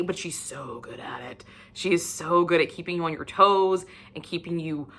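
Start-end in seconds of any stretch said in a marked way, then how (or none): 0.0-1.4 soft confiding whisper; hushed aside. Real, not acted. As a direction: breathe it out as a secret. Confiding aside about the author.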